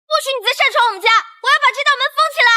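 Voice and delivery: fast, very high-pitched scolding; shrieky, hurried telling-off; indignant outburst. Acted. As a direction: lay down the law in a high, rapid rant. Quarrel over barging into home.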